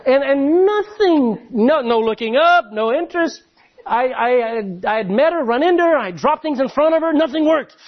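comic retelling of a hopeless courtship; exasperated. Retold or imitated. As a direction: pile up the failed attempts with rising disbelief.

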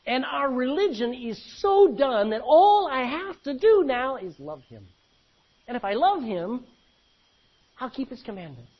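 preaching. Acted. Preacher exhorting the congregation, full-force preaching. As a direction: build it up like a pulpit climax.

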